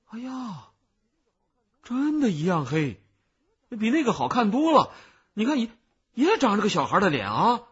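high, loud onlooker amazement; amazed exclaiming. A narrator's reading. Onlookers marvel at the child's cicada, astonished. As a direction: exclaim in wonder, pitch way up.

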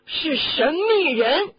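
high, loud, drawn-out accusation; indignant outburst. Acted. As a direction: hammer home the bitter accusation. Bitter outburst about the traitor's true concern. (愤慨地高声拉长)

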